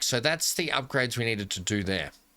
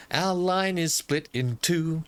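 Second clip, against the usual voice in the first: high, slow lament; drawn-out dismay; 6 semitones higher, slower than usual. Real, not acted. Player to viewers on a broken line, drawn-out dismay. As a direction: stretch it out in dismay. Realising his track mistake, wailing.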